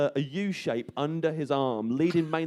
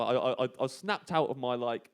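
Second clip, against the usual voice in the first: ordinary delivery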